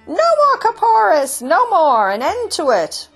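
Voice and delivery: high, loud rallying chant; squawky protest, starting high and coming down; excited animal cries. Acted. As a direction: cry out like an agitated flock. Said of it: "burst into excited cries".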